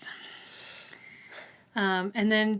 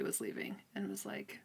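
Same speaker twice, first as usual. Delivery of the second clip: unvoiced whispered narration; 13 dB quieter than usual. Real, not acted. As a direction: tell it in a hushed whisper. Aside during talk, unvoiced.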